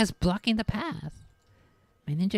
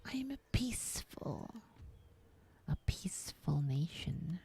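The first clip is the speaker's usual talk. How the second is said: whispered self-pity; 7 semitones higher, 9 dB quieter than usual. Pretend. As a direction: breathe it out like a sulking victim. Player pities his peaceful nation.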